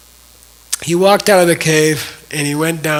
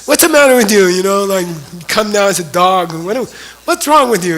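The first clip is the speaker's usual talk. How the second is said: retold outburst, higher and louder, scolding; angry reproach; 5 semitones higher, 5 dB louder than usual. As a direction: scold Maitreya for twelve years of waiting. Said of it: "Then he got angry at Maitreya".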